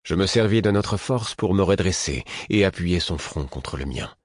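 ordinary delivery

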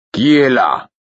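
loud, high call through a door; shouted. A narrator's reading. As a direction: call out to whoever knocked. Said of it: "cria Burick".

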